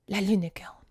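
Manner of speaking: breathless hushed whisper. Retold or imitated. Robbers' men naming the bandit, whispering. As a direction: whisper the name in awe.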